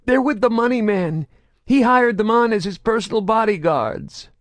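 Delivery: high, loud shaky confession; trembling. A narrator's reading. Grotto tells Saren, voice trembling. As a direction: keep the tremble going. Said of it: "his voice trembling".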